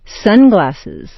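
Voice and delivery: sharp stress on "Sun" in "Sunglasses"; "Sun" peaks, "glasses" drops away; first noun stressed. Shown as a demonstration. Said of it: "first one is sharply stressed".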